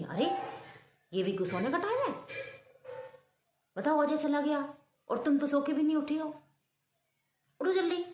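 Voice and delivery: loud, high scolding to wake her up; mother-in-law scolding her late-sleeping daughter-in-law; an angry mother-in-law shouting the girl awake. Acted. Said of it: "सासू माँ तो कुपित हो गई".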